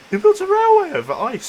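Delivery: high, loud disbelieving cry; incredulous shout. Real, not acted. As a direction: yell it in total disbelief. Repeats the question far louder.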